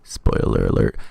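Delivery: deep, loud booming spoiler warning; announcer warning. Acted. Warning viewers of spoilers, deep booming announcer. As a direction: boom it like a movie trailer voice.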